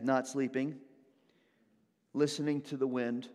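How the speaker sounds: ordinary talk, nothing marked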